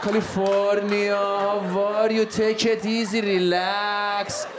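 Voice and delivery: put-on drawn-out, lazy Iranian accent; hammed-up Iranian accent, very slow. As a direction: stretch every word in an Iranian accent. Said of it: "Every word we drag out".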